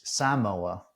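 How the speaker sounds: Samoa with the first A stressed. Shown as a demonstration. Host checking the name he learned, first syllable of Samoa stressed. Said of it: "Emphasis on the first A".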